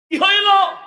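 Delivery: high, loud outburst of alarm; alarmed cry. Acted. Mother reacts to child's blurred sight, alarmed cry. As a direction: shout it out high and sudden.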